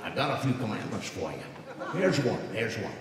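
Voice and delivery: put-on deadpan like an old vaudevillian; George Burns as God. God handing Moses commandments, old comic rasp. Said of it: "George Burns".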